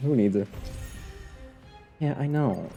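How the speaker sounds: ordinary talk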